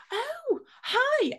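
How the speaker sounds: high-pitched, surprised "oh, hi"; delighted greeting. Retold or imitated. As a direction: greet someone you suddenly recognize. Greeting a recognized voice brightly.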